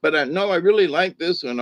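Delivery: put-on high pleading child voice; little girl voice. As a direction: beg sweetly like a small girl. Four-year-old voiced in story.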